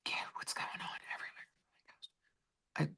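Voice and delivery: breathy hushed whisper, slowed down; a real whispered aside. Real, not acted. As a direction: drop to a secret whisper.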